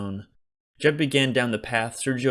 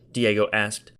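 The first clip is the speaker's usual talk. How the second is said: usual speaking voice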